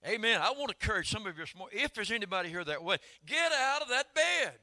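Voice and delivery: loud, high, stretched preacher's cry; preaching at full force. Acted. Preacher urging the congregation out of bed, at full force. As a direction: call them out of bed like a revival preacher.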